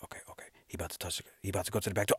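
low, quick, breathy whisper fading out; hushed whisper. Retold or imitated. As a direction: sneak off without a sound. Whispering at kitchen window.

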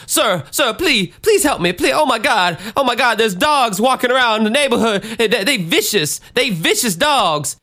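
put-on high-pitched panicked old lady, pleading; frantic old woman impression. Terrified woman begging a neighbor for help from dogs, high frantic impression. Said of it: "this is exactly how she sounded".

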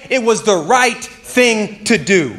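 slow, deliberate, every word weighed; solemn answer. Retold or imitated. Veterans answering why they went to war, slow and solemn. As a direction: let it land as a simple truth.

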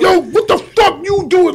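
retold scolding. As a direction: snap it like an exasperated boss. Retells boss scolding him in studio.

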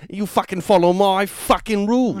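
high, loud sweary rant; angry boss. Pretend. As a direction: berate the rookie like a furious chef. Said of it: "Is the sergeant played by Gordon Ramsay?".

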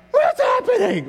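shrill, high, laughing shock; laughing surprise. Pretend. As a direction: squeak it out, half laughing. Reacting to a talking boa.

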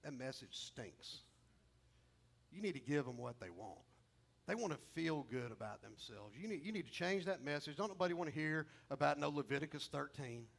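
a nagging doubt, low and soft; gentle whisper. Retold or imitated. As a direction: murmur it like a tempter at his ear. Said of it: "this little whisper in my ear, super gently".

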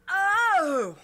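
high, loud surprised oh; loud high startled oh. Acted. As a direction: gasp it out. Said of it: "the taller of the two goes".

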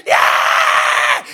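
very high, loud shout of victory; triumphant shout. Retold or imitated. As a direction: let the crowd roar. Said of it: "gritaron más fuerte". (grito triunfal muy agudo y fuerte)

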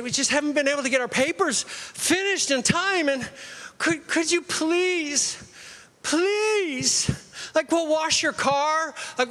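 mock-pleading student, building from casual to high and whiny. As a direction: beg like a student angling for an extension, rising into a high plea.

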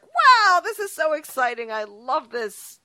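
high, loud wow easing into delight; gushing excitement. Retold or imitated. Host recalling the team's hot streak, gushing with excitement. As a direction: gush like a thrilled new fan.

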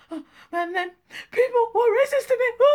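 hammed-up sobbing with gasps, high and loud; re-enacted tearful breakdown, slow and high; crying, overwhelmed. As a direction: gasp and wail it out like you can't finish. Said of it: "I just was like this".

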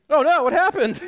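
real laughter breaking through a high, loud question; laughing. Real, not acted. Amused teacher laughing at Peter's fate, high laughing burst.

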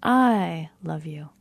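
slow, "I" drawn out and punched; stress on I. Shown as a demonstration. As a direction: stretch "I", then finish the phrase lightly. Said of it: "you can feel the difference".

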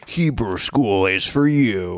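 slow booming rally announcer; monster truck ad. Acted. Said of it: "that rally voice".

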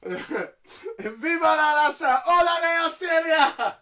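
mock Guerrero cheer. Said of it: "they're thanking Australia".

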